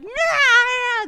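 high, loud panicked lamb bleat; imitated lamb bleat. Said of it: "That's the sound it was making".

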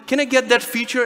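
high, mock-pleading user. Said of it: "users are like".

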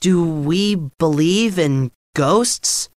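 hesitantly ask, a touch of nerves; nervous. A narrator's reading. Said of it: "said Bill nervously".

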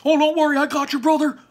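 very high, loud cartoon brother voice; squeaky Barley. Barley catching his brother, high and chirpy. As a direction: chirp it reassuringly.

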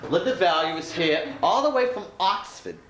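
lightly voiced game show announcer; TV host. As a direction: introduce her like a TV presenter. Hosts a mock TV show.

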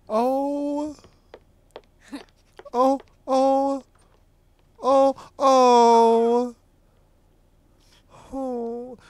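high, drawn-out adoring moans; moaning over cuteness. Real, not acted. Player moaning over a dog, adoring high moans. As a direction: moan "oh" high and helplessly, repeatedly. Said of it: "me moaning over a dog".

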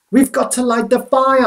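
high, loud desperate plea; urgent plea. A narrator's reading. Boy begging Kensuke to light the signal fire, loud and high. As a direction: insist with rising panic.

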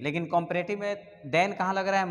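usual speaking voice

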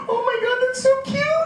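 very high-pitched melting coo; gushing. Retold or imitated. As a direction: imitate a woman overcome by cuteness. Actress melts at boy's wish.

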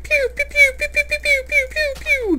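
squeaky high pew-pew blaster noises; high-pitched mouth laser effects; laser pew sounds. Pretend. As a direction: zap it like a toy ray gun. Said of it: "so I can go like".

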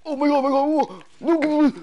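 high, loud panicked cries; panicked shriek. Real, not acted. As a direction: scream it out in panic.

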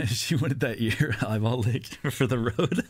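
laughing through the words, slowed down. Real, not acted. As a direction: crack up while getting the joke out. Laughs at his own joke.

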